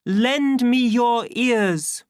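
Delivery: high, loud, drawn-out oratory; pleading cry. A narrator's reading. Savage addressing the twins in public, crying out. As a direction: hold each word out across a crowd. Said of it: "cried the savage".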